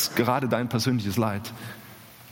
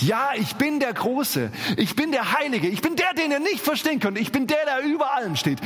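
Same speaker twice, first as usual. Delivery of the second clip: God proclaiming, pitched much higher; grand voice of God; 11 semitones higher than usual. Acted. Preacher voicing God to the congregation, majestic and high. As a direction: ring out each line as the almighty.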